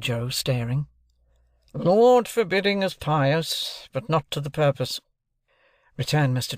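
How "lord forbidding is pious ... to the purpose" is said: raised, deliberate lawyer's voice; stern lawyer. Acted. Jaggers introducing himself to Joe and Pip, stern lawyer. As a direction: announce yourself like a cool London lawyer.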